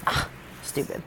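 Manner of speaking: a quick whispered curse at herself; whispered self-scolding. Real, not acted. Vlogger annoyed at tripping over her words, whispering to herself. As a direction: mutter it under your breath in annoyance. Frustrated with her own stumbling.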